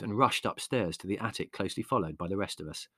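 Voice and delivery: ordinary delivery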